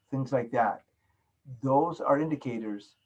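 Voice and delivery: normal talking voice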